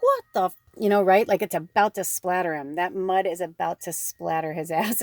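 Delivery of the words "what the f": high, loud startled cut-off curse; shocked outburst. Pretend. Suitor startled as she leaves, shocked cry. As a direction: stop short, shocked. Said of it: "And he's like".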